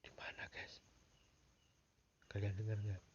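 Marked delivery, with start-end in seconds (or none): 0.1-0.8 voiceless hushed whisper; whisper, scared of being heard. Real, not acted. Hiding at night, hearing noises.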